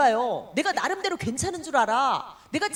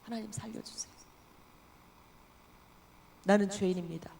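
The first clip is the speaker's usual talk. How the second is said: very soft, low plea of a crushed sinner; a sinner fallen on her face, begging God in a hush; broken whisper plea; 5 semitones lower, 8 dB quieter than usual. Retold or imitated. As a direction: barely voice it, face down before God. Deaconess collapses before God.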